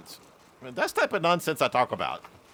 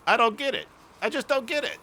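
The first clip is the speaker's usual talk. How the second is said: higher-pitched imitation of puzzled listeners; mock bafflement; 8 semitones higher, faster than usual. Mimicking everybody else not getting the joke, mock bafflement.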